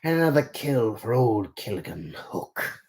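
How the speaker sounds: slow, lightly voiced gloating villain; a hint of pirate villain; Killigan Hook. Shown as a demonstration. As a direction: savor the kill at an easy pace. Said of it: "So how was that voice?".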